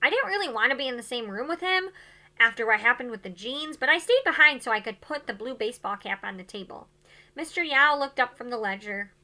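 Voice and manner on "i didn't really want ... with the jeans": ordinary delivery, nothing marked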